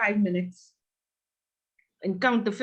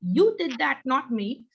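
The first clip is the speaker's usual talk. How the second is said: retold indignant retort; 6 semitones higher than usual. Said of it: "And he said".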